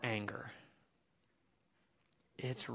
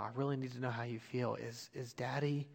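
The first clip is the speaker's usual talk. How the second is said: tender plea; 7 dB quieter than usual. Retold or imitated. Dad gently asks daughter.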